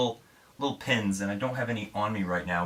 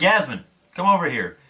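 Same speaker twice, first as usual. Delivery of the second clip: calling her over, higher and louder; 6 semitones higher, 5 dB louder than usual. Retold or imitated. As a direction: call her across the room. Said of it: "I'm like".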